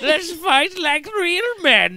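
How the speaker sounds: high, slow mock heroic line; hammed-up villain voice. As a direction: ham it up like a cartoon villain. Mocking the Gray Fox voice.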